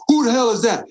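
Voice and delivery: high-pitched mock outcry; retold indignant demand. Asking who is screaming on set, mock indignant outcry. Retold reaction to someone yelling.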